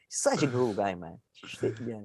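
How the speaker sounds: ordinary delivery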